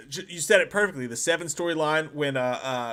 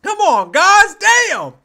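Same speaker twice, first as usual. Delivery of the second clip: high and much louder, a real cry of protest; dismayed outcry; 13 dB louder, slower than usual. Real, not acted. Host protesting the chat's vote, loud outcry. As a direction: yelp it at the chat like you got robbed.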